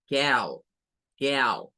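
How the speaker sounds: cow said with an open ow sound; cow, rounded like the a in backpack; English cow. Shown as a demonstration. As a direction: cow drawn out slowly. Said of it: "que suena con la misma A".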